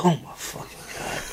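breathless whispered exasperation; whispered disbelief. Real, not acted. Disbelief at Cluck and Bell.

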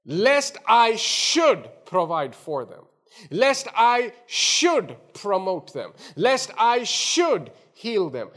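slow, loud, SHOULD punched each time; stress on SHOULD. Acted. Preacher voicing God's intent for his people, stress on SHOULD. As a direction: lean hard on SHOULD, pause after it. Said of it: "there is an emphasis on that word".

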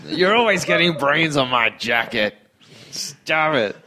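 fussy, scolding roommate voice; nagging roommate. Pretend. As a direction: scold like a neat freak. Playing nagging zombie roommate.

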